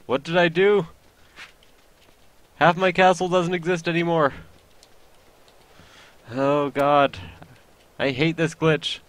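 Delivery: high, loud dismay; alarmed. Real, not acted. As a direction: panic over lost castle. Game glitch destroys castle.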